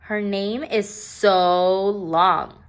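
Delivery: girl mocking a classmate's long name, slow; snide remark. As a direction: stretch it out to sneer. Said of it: "said Jo".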